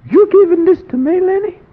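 very high, loud, stunned cry; can't believe the gift; astonished disbelief. Retold or imitated. Friend stunned, then Lenny insisting.

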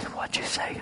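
hushed, voiceless curious question; whispered question. Retold or imitated. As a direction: ask it in a secretive whisper. Others asking, retold.

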